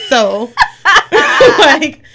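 ordinary talk, nothing marked

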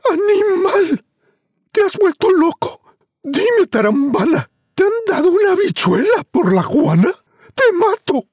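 very high, shrill furious yell; furious shrieking mother. Acted. Mother screams at Juan over the bean. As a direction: scream at him in outrage. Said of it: "le gritó".

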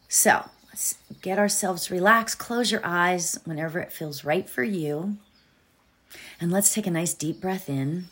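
soft whispered meditation guide; whispering. Acted. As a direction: breathe it out softly, close and hushed.

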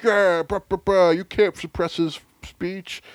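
high, slow dude-bro voice. Retold or imitated. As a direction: drawl it like a bro. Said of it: "right wingers are like".

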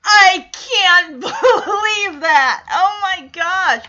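slow, laughing through the words; real laughing shock, drawn out; can't believe it, laughing; laughing disbelief. Real, not acted. Reacting to the show's reveal.

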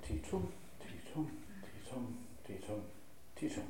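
softly counting "Ti tum", short "ti", long stressed "tum"; iambic beat. Shown as a demonstration. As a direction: beat out five quiet "ti tum"s. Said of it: "How many ti tums can you get in it?".

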